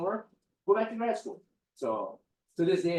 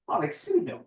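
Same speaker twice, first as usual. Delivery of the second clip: excited realization; 6 dB louder than usual. Retold or imitated.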